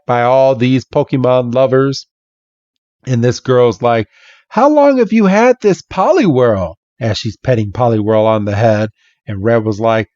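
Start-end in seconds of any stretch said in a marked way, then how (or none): 4.5-6.7 put-on high girl voice. Girl petting Poliwhirl.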